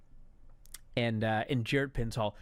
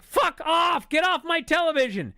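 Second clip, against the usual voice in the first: high, loud shouting at the TV; fed-up yell; 12 semitones higher, 8 dB louder than usual. Retold or imitated. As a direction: yell them off the screen, exasperated. Re-enacts yelling at the TV.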